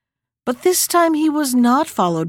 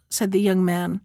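ordinary delivery, nothing marked

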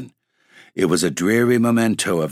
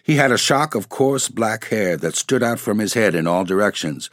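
ordinary delivery, nothing marked

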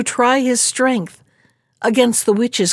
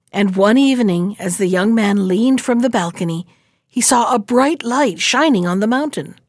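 plain reading voice

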